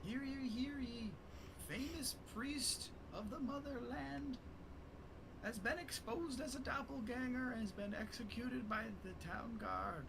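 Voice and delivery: high, slow proclamation; town crier. Acted. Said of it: "boy is just kinda yelling".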